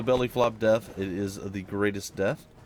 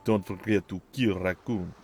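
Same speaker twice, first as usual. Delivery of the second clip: put-on lightly done Russian accent, dropped articles; a hint of a Russian announcer; 4 semitones lower than usual. Quoting the game's Russian narrator, light Russian accent. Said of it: "that Russian accent".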